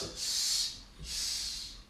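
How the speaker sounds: two soft unvoiced S hisses; hissed s sounds. Shown as a demonstration. Teacher isolating the S sound twice. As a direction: hiss "S, s" softly. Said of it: "You have the sound s twice".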